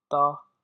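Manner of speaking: ता said loud and clear as one syllable. Shown as a demonstration. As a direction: blend त and आ into ता. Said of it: "तो त और आ".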